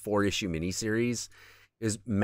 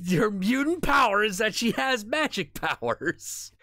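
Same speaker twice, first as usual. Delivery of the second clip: laughing; 10 semitones higher than usual. Real, not acted. Repeats it laughing.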